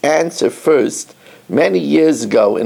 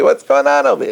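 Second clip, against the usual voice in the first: much higher, baffled question; can't believe what we're singing; puzzled rabbi asks his listeners; incredulous; 10 semitones higher, faster than usual. Real, not acted. Incredulous at the song's meaning.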